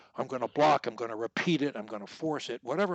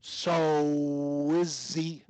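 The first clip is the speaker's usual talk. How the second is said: "So" held long and run into "Izzy"; connected as one word; much slower than usual. Shown as a demonstration. Coach giving the student another link, connected as one word. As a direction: stretch "So" straight into "Izzy" without a break. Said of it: "I'm connecting it as one word".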